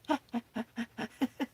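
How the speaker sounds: high, light chuckle; self-amused laugh. Real, not acted. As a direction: giggle at your own story. Laughing at own principal's office story.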